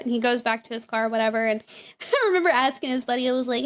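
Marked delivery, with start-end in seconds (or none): none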